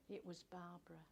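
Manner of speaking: ordinary delivery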